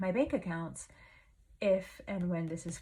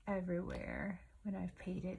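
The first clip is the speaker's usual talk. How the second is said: ordinary delivery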